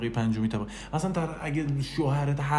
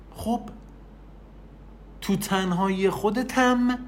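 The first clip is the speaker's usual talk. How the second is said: ordinary delivery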